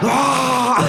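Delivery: hammed-up falling scream; long high yell of fake terror; cartoonish panicked cry; high mock scream. Guy breaks through the window.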